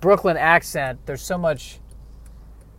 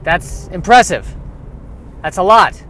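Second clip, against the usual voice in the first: mock impressed; 3 semitones higher than usual.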